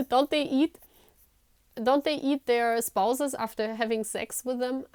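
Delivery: ordinary talk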